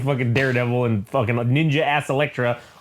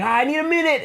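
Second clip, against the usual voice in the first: high put-on woman's voice; mock Elektra; 13 semitones higher than usual. Elektra after the fight, high mock woman's voice. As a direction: falsetto a breathless woman.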